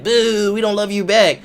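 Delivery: high, loud mock crowd boo. Fans jeering the wrestler who praised Australia, high mock booing. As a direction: call it out like a heckling crowd.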